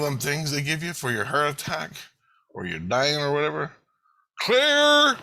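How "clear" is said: high, loud, drawn-out "clear" call; defibrillator shout. Acted. Medic warning the team before a heart-attack jolt, loud shout.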